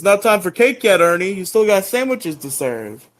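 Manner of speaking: high, loud scolding buddy; a high, loud teasing reminder to Ernie; Jason voice. Retold or imitated. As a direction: chide him like a big brother. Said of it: "he's like".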